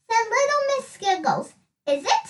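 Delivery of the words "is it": ordinary delivery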